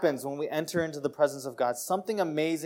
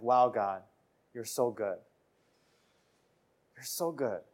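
soft, lower awed thanks to God; hushed grateful awe; 6 semitones lower, 5 dB quieter than usual. Retold or imitated. A worshipper softly thanking God, quietly awed. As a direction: breathe it out in quiet wonder. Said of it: "You're like".

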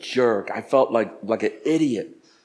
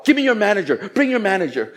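upset retold demand; 7 semitones higher than usual. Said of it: "I was causing a little, little scene".

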